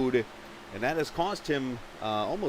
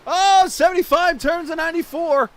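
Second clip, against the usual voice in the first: excited call; 11 semitones higher, 8 dB louder than usual. Real, not acted. Car spins on pit road.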